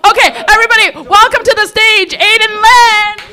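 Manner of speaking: host welcoming the comic to the stage, loud emcee call; announcer intro. Real, not acted. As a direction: bring the next comic up big.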